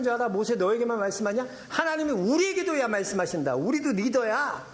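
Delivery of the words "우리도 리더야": defiant, slow 우리도 리더야 with a falling end; a hint of a sulky rebel; defiant claim. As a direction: push back stubbornly with 우리도 리더야. Miriam and Aaron claim leadership.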